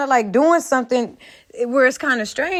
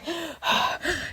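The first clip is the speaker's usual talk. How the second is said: weary sigh; 5 semitones higher, 12 dB quieter than usual. Retold or imitated. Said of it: "You're not like".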